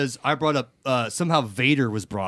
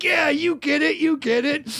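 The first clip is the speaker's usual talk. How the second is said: coworker gushing in a much higher voice; high mock coworker voice. Coworker agreeing about Vader, high mock voice. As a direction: squeal it out high and pleased. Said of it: "He's like".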